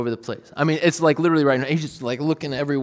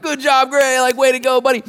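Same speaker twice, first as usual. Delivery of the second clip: much higher, louder cheering; excited praise; 12 semitones higher, 9 dB louder than usual. Retold or imitated. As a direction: cheer him on like a proud dad. Dad cheering son's success.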